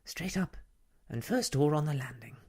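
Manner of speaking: high, brisk, thin-voiced lady; huffy, hurried high voice; thin, outraged voice. A narrator's reading. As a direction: give curt directions, high, fast and loud. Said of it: "thin, outraged voice".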